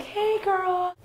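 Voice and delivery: put-on high, sugary "hey girl"; sweet greeting. Shown as a demonstration. Showing how to greet a roommate, sweet and high. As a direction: coax her gently with sweetness. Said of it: "be a little sweet about it".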